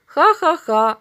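put-on a loud, high burst of boyish ha-ha-ha; mocking laugh. A narrator's reading. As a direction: laugh out loud at a friend's fib. Boy laughs at the lie.

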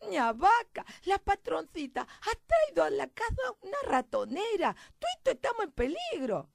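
high, loud country mouse voice; alarmed little mouse. Acted. Mouse tells the hen about the mousetrap, alarmed.

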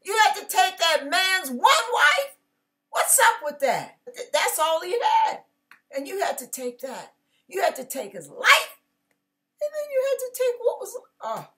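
a hint of God scolding David; rebuking God. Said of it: "And the Lord said".

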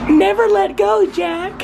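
very high, slow swooning plea; Titanic Rose voice. Pretend. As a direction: melodramatic movie heroine. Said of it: "Have you guys ever seen Titanic?".

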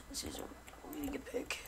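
soft, unvoiced whisper. Gamer marvelling to himself at a really good pick, whispering. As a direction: whisper it under your breath. Repeats the remark, dropping to whisper.

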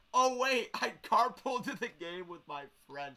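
loud, high burst of dismay; sudden realization. Retold or imitated. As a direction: blurt it as it hits you. Acts out his dismayed sudden realization.

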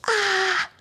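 high cry of effort; high strained cry. Real, not acted. Coach straining hard mid hold, crying out.

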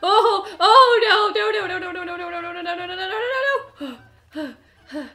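high, loud, breaking into a laugh-cry; a frantic string of no's; panicked no's. Real, not acted. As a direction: player in real panic as an enemy closes in. Enemy attacking, desperate repeated no.

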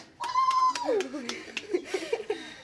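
short high burst of laughter; laughing. Real, not acted. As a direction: break into a laugh. Laugh after asking for applause.

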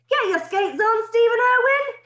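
high, loud headmaster's yell. Acted. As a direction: bellow at the late boys across the yard. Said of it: "he yelled".

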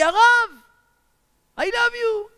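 high, loud call from a distance; excited high-pitched cry back. Retold or imitated. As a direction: holler affection up high. Man on breakwater calls back.

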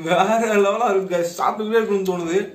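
chewing while talking; speaking between bites; mouth full. Real, not acted. Seen 0: Bit fish at -4s; jaw still working as he talks.

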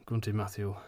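plain reading voice

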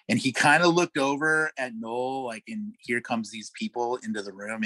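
ordinary delivery

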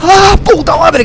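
loud slow jump-scare yell; real fright bursting out; startled shout. Real, not acted. Susto repentino. (grito de susto real)

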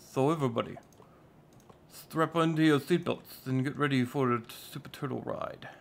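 mock hype announcer voice; hammed-up announcer. Hyping his viewers for the Super Turtle challenge, announcer style. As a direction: sell it like a ride announcer. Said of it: "I don't know why I'm talking like that".

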